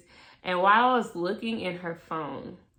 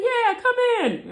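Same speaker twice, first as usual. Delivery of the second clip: much higher cheery invite; mock cheerful older boss; boss's voice; 13 semitones higher, 4 dB louder than usual.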